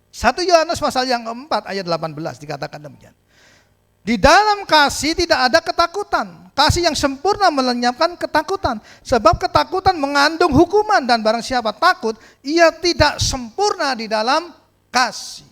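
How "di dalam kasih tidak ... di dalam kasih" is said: loud, high declaiming; fervent Bible reading; preached scripture reading. Acted. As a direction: proclaim the verse.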